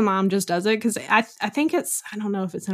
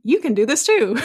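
infomercial voice; 4 semitones higher, 4 dB louder than usual. Retold or imitated. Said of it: "You sound like an infomercial".

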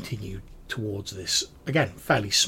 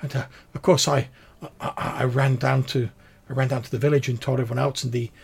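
higher, louder, halting woman's voice; distressed village woman witness; 5 semitones higher, 8 dB louder than usual. Acted. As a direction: recount the abduction in agitated stammers.

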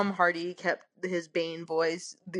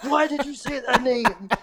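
mildly raised, hammed-up outburst; mock yell; 4 semitones higher, 4 dB louder than usual. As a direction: retell it as a mock demand. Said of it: "Bruce Wayne keeps yelling".

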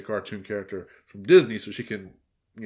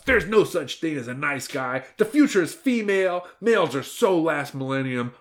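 high, emphatic dismissal; retorting girl boss; 6 semitones higher than usual. A narrator's reading. Girl boss dismisses males to the wolf, retorting. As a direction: lecture him in a raised, sassy voice. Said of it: "retorted the girl boss".

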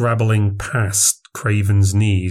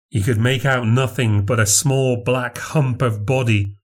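usual speaking voice

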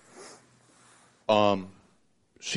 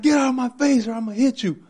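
high mock yell, much higher; imitating mom yelling; 13 semitones higher, faster than usual. Retelling mom threatening him, a high mock yell. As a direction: shriek the threat in her high voice. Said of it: "She yells, like, she shrieks".

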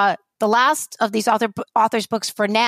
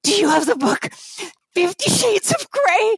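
excited customer; 9 semitones higher than usual. Retold or imitated. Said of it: "they're like".

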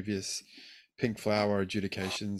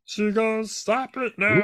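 high, loud mock telling-off of the seagulls; mock scolding; 11 semitones higher, 5 dB louder, slower than usual. Host playfully tells off seagulls, high and loud. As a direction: shoo the seagulls like a fussy parent.

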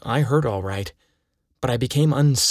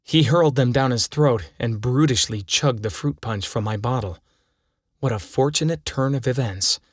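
ordinary delivery

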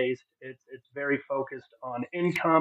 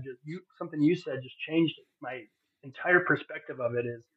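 ordinary talk

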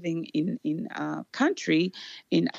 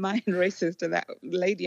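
ordinary talk, nothing marked

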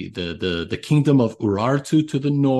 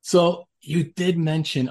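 ordinary delivery

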